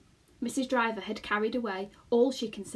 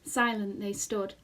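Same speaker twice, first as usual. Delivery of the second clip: ordinary delivery, nothing marked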